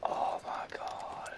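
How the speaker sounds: slow breathless whisper; awed whisper of disbelief. Real, not acted. Stunned by the game.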